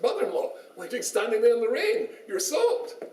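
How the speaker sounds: high-pitched exclaiming at her; rising mock concern, pitched well up; mock astonishment. Son-in-law finds mother-in-law in the rain, mock astonished.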